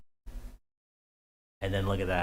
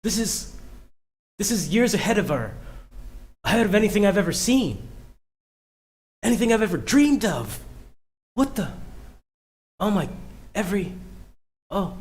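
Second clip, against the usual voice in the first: awed; 9 semitones higher than usual. Retold or imitated. Voicing awed character reaction.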